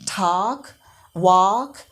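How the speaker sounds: "talk, walk" with open ah vowels; American ah sound. Shown as a demonstration. Teacher modelling American English, "talk, walk" with ah. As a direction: say "talk, walk" like "tahk, wahk". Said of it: "ah sound".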